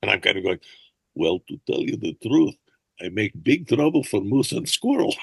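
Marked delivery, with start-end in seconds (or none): 1.2-5.2 hammed-up Russian accent; Boris Badenov. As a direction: play a cartoon villain with a thick Russian accent. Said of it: "speak with a terrible Boris Badenov accent".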